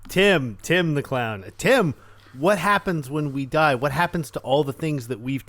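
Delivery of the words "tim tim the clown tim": ordinary talk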